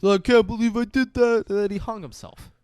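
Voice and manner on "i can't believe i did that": high, loud whiny regret; mock a remorseful loser, pitched way up; mock Judas. Judas regretting his betrayal, mocking high voice.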